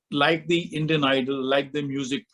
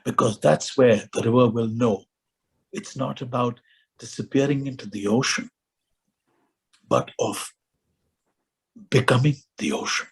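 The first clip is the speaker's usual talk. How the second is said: measured recitation with long pauses, sinking lower; poem recitation; 4 semitones lower than usual. Acted. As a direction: recite it gravely, letting each phrase stand alone. Recites a poetic passage.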